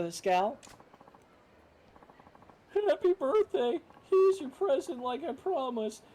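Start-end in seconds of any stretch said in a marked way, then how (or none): 2.9-6.0 put-on much higher, bright young boyfriend voice; high eager boyfriend. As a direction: play the lovesick boyfriend, pitched up. Boyfriend voice again.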